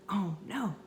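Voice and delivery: ordinary delivery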